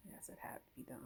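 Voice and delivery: hushed, breathy whisper to herself; whispered aside. Real, not acted. After cutting her locks, she whispers to herself. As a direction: whisper it like reassuring yourself.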